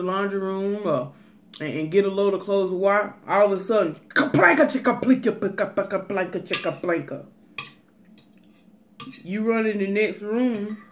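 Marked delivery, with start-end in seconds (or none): 4.1-7.3 crash noise. Retold or imitated. Imitating breaking crash sound.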